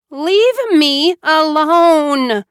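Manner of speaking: high, loud, slow desperate scream. A narrator's reading. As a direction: scream at her sister to go. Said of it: "Joanna screamed".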